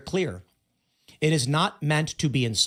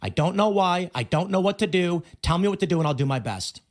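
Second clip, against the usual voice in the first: normal talking voice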